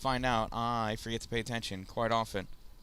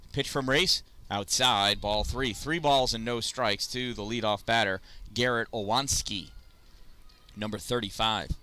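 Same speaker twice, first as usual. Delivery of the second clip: rising, louder sportscaster call on the big swing; live play-by-play; 2 semitones higher, 4 dB louder than usual. Acted. Calling the at-bat for viewers, excited play-by-play. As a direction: call each pitch like a ballpark announcer. Calls live pitches on the field.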